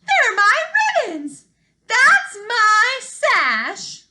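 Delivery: very high, loud, drawn-out shriek; shrieking stepsisters. Acted. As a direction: shriek it in jealous outrage. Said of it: "The stepsisters shrieked".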